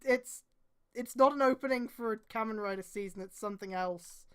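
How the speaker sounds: usual speaking voice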